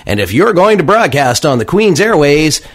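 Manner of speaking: high, loud radio announcer voice. Shown as a demonstration. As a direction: put on the smooth on-air voice. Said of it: "here, I'll slip my radio voice on".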